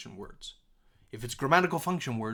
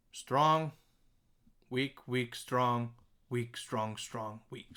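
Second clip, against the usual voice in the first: each "strong" heavier, each "weak" dropped lighter; beat pattern; much slower than usual. Shown as a demonstration. Teacher reciting the line's beat pattern, rhythmic stress. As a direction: chant the beat, leaning on "strong". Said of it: "so our beat pattern".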